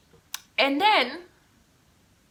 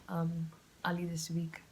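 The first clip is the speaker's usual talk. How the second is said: whispering; 2 semitones lower, 7 dB quieter than usual. Real, not acted. Trailing aside.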